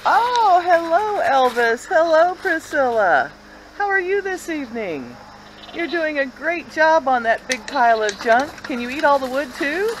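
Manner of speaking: high, loud sing-song cooing to the chickens; high pet-talk voice. Real, not acted. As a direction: greet the birds like babies, bright and sweet.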